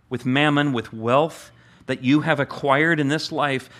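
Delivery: normal talking voice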